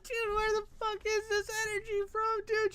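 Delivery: very high, squeaky disbelief; high-pitched, flabbergasted outburst; incredulous squeal. Real, not acted. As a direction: squeal it out, overcome with amazement. Incredulous, overcome by the clip.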